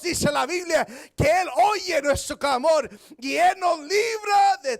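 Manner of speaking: high, loud impassioned preacher; full-force preaching. Real, not acted. Preacher assuring the congregation God hears, full-force preaching.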